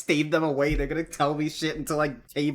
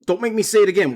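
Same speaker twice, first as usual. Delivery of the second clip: high, loud scolding; loud teacher voice; 8 semitones higher, 8 dB louder, much faster than usual. Retold or imitated. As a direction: shout it like a strict teacher. Another take of the line.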